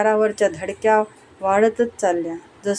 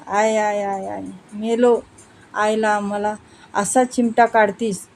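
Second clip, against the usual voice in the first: slow, drawn-out moans of pain after a pinch; moaning in pain; slower than usual. Acted. Husband moans when his wife pinches him. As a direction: groan aye aye as if pinched hard. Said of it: "तो विव्हळून म्हणाला".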